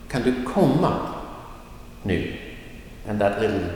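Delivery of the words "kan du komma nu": quick "kan du", emphasized "komma", light "nu"; stress on komma. Shown as a demonstration. Teacher giving an example question, stressing main verb "komma". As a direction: run through "kan du" and lean on "komma". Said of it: "that is emphasized because that's the main verb".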